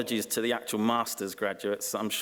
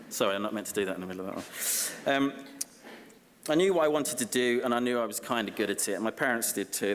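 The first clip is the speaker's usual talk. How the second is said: normal talking voice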